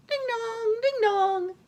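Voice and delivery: high sing-song ding-dong; doorbell imitation. A narrator's reading. Wolf rings the bell at the cottage, high chiming voice. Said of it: "Wolf rang the bell again".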